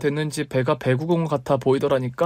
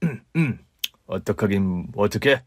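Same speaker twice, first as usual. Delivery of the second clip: dismissive husband; 4 semitones lower than usual. Acted. Husband brushes it off.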